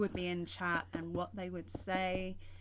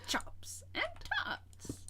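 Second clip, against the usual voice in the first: whisper; much slower than usual. Pretend. Echoes the whispered chant.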